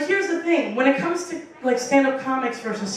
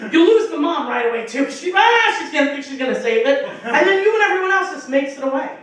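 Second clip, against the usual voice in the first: ordinary delivery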